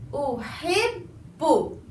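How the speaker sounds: Uhibbu very slow with a held bb; doubled b. Shown as a demonstration. Teacher gives the last example, very slow. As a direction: draw out uhibbu, lean on the bb. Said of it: "The last example".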